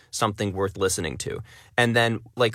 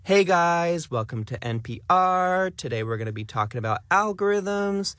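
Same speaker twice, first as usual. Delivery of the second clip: lifestyle influencer accent; 8 semitones higher than usual. Shown as a demonstration. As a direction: greet followers like a lifestyle influencer, stretching line ends. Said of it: "most famous sort of lifestyle influencer accent".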